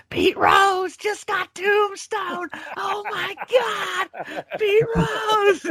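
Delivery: hammed-up ringside announcer shrieking in disbelief, very slow; J R screaming. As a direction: imitate a commentator's high, drawn-out scream. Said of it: "J R is absolutely screaming".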